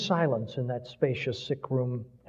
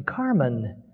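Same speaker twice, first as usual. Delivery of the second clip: pressing, insistent call; urgent. Acted. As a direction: call him back with urgency. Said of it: "More urgently".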